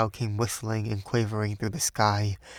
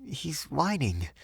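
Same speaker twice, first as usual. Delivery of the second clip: higher, softer and slow, nervous; 6 semitones higher, 4 dB quieter, slower than usual. A narrator's reading. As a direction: say it with rising unease. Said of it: "she continued nervously".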